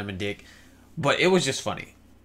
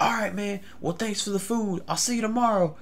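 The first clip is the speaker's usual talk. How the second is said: higher-pitched crazy stranger; drugged stranger; 9 semitones higher than usual. Retold or imitated. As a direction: play the man on drugs, pitched up. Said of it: "either he was crazy or he was on".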